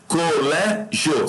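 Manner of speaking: "Colégio" very slow, split co-lé-gio; syllable by syllable. Shown as a demonstration. Teacher checks syllable division, "Colégio" drawn out in pieces. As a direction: stretch out each syllable of "Colégio". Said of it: "correta organização das sílabas".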